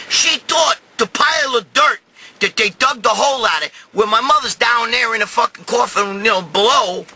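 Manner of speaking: normal talking voice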